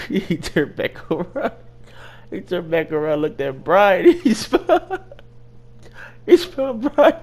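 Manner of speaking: high, helpless laughing speech; cracking up. Real, not acted. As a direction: fight through laughter to tell it. Cracking up at own story.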